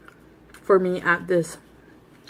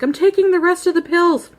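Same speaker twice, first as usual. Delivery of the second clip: defiant threat; 11 semitones higher, 4 dB louder than usual. Retold or imitated. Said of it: "In the bathroom, I'm like".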